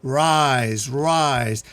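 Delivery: loud, drawn-out summons; commanding, projected call to rise up; projecting voice. A narrator's reading. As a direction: call it out with force. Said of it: "we hear the voice again".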